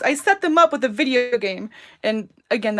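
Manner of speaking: ordinary talk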